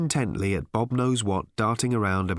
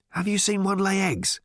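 high, probing question; suspicious; 8 semitones higher than usual. A narrator's reading. Ford doubts the bird is real, asking suspiciously. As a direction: question it as if expecting a trick. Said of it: "said Ford suspiciously".

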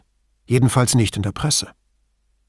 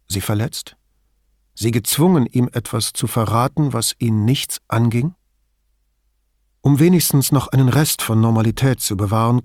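usual speaking voice

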